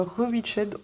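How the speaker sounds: English title said with a French accent; Rewitched in a plain French accent. Shown as a demonstration. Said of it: "avec l'accent français".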